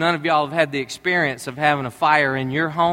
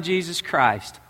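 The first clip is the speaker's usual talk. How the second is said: ordinary delivery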